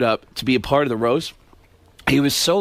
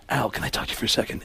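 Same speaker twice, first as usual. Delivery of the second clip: whisper; 5 semitones lower, 7 dB quieter, faster than usual. Retold or imitated. Alec pulls Pacino aside.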